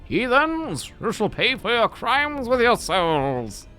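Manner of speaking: high, slow, strange lizard voice. Acted. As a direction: hiss it out as a menacing lizardman. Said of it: "I don't know if that voice fits for that".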